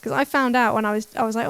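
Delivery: ordinary talk, nothing marked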